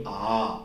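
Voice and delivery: आ held twice as long as अ. Shown as a demonstration. Teacher showing pupils the long vowel: आ drawn out. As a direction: stretch आ out to double length. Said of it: "दो गुना ज्यादा समय हमें आ पर देना है".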